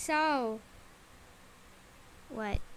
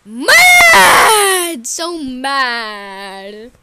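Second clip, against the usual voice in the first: tantrum; 5 dB louder, much slower than usual. Pretend. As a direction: burst out in play-acted fury. Tantrum resumes after onlooker comments.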